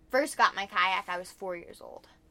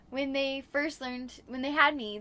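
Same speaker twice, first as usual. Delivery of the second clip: usual speaking voice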